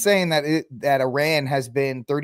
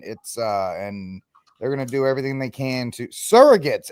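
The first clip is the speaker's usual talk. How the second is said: usual speaking voice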